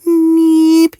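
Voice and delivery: high, loud buzzing neep of a mosquito; tiny insect squeak; mosquito whine. Acted. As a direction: whine like a mozzie in your ear. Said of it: "whack yourself in the other side".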